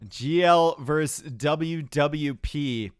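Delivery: slow, loud, stretched arena-announcer call; announcer intro. Acted. Caster announcing the match to the stream, drawn-out hype call. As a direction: boom out the matchup like a ring announcer.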